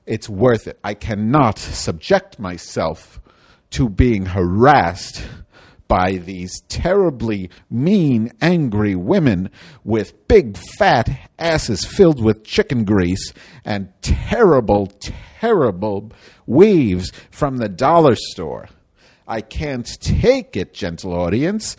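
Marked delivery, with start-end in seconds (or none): 0.8-18.7 slow, loud, outraged declaration; indignant rant. Acted. Madge ranting to the audience, slow and loud. 19.3-21.7 melodramatic. Acted. Melodramatic plea to audience.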